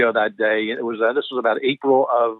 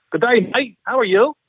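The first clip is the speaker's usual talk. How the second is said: high, cheery Australian greeting; Australian dialect, pitched well up; Australian accent; 11 semitones higher than usual. Retold or imitated. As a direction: imitate an Aussie actor saying hello. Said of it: "in his Australian, you know, dialect".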